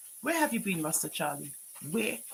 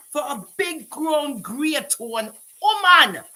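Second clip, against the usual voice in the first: scornful scolding; 9 semitones higher, 6 dB louder, slower than usual. Real, not acted. Scolding the woman.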